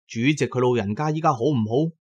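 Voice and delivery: ordinary talk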